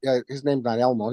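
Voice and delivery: ordinary talk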